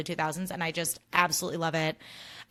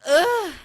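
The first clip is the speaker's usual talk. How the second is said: loud, high exasperated ugh; fed up with drivers, groaning; frustrated groan; 10 semitones higher, 9 dB louder than usual. Real, not acted. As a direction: let out disgust.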